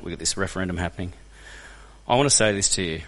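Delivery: ordinary talk, nothing marked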